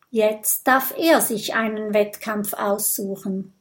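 plain reading voice

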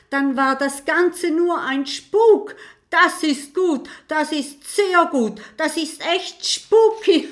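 high and bubbling with laughter, laugh breaking through at the end. A narrator's reading. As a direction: talk through the laughter, delighted. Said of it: "bricht in schallendes Gelächter aus". (hoch und lachend gesprochen)

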